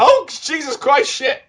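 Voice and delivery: high, slow, drawn-out mock alarm; retold panic. Both players fleeing the invader at the lift, mock panicked. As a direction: blurt a hammed-up panicked oath. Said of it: "I panicked and yelled".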